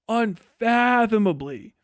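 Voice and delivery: normal talking voice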